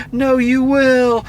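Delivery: high, slow, whiny put-on sob; hammed-up weepy insistence; mock tearful girlfriend. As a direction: drag it out like fake crying. Mocking crying girlfriend's excuses.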